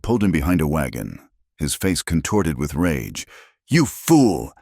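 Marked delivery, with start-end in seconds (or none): 3.7-4.5 high-pitched, angry rebuke; venomous hiss. A narrator's reading. Cartwright to Pike behind a wagon, venomous hiss. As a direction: spit out a venomous reproach. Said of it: "he hissed, his voice a venomous whisper".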